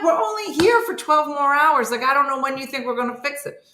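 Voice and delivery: high-pitched, fed-up arguing; exasperated protest. Retold or imitated. Acted argument with Jason over repairs.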